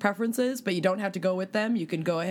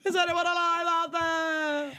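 calling out; 8 semitones higher than usual. Acted. Titanic quote, calling out.

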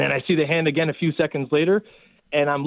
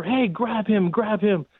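retold yell; 5 semitones higher, slower than usual. Rescuer calling to a surfer to grab the boy, mild mock yell. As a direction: call it out to the surfer like a retold shout. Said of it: "I yelled at the surfer".

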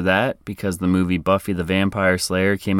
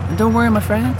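high sing-song; 14 semitones higher, 4 dB louder, faster than usual. Acted. Mock reassurance.

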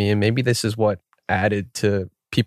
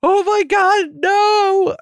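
high, far up, wailing pregnant wife in panic; the horrified pregnant wife watching her husband bitten, shrieking high; mock wife's wail; much slower than usual. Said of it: "his pregnant wife, is like".

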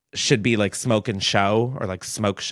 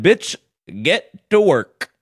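bossy quote; 4 semitones higher, 4 dB louder, slower than usual. Retold or imitated. Quoting listener's bossy voicemail.

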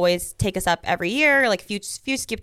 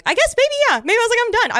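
high excited recall; 6 dB louder, much faster than usual. Real, not acted. Reliving the moment, high and loud.

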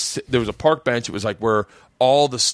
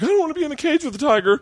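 high, fast scared whine; high quick panicked refusal; scared protest; faster than usual. Retold or imitated. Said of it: "And I was like".

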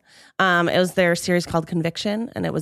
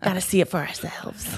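softer conspiracy theorist voice; a hint of a paranoid truther; 4 dB quieter than usual. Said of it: "It's my conspiracy theorist voice".

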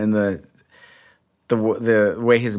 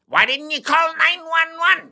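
high, urgent courtroom impression; Sam Waterston. Comedian demonstrates an actor's percussive delivery, high and urgent. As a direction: demand it like a pitched-up TV prosecutor. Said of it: "what was Sam Waterston like?".

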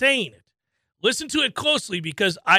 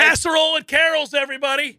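mock party announcement; 7 semitones higher, 4 dB louder than usual.

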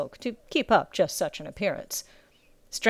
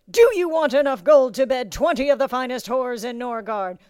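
raised, louder drill master yell; bellow; 4 semitones higher, 5 dB louder than usual. A narrator's reading. Drill master testing recruit's will, bellowing. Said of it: "such a bellow to come".

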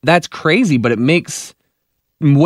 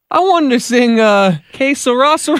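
higher-pitched put-on Irish lilt; imitated Irish accent; 8 semitones higher than usual. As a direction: play a puzzled singer stuck in an Irish accent. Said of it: "[name] from the Cranberries was in there".

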